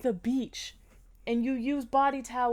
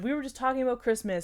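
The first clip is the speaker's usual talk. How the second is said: ordinary talk, nothing marked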